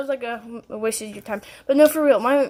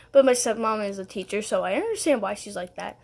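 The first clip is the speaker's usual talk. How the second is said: ordinary talk, nothing marked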